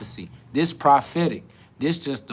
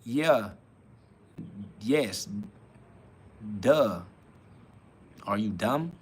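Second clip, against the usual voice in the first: deadpan; 7 semitones lower, 9 dB quieter, slower than usual. Retold or imitated. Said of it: "I'm like".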